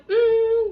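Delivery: very high, loud doubtful "mm"; drawn-out high hum of mock doubt; skeptical hum. Babysitter retells doubting the dad's reassurance, skeptical high hum.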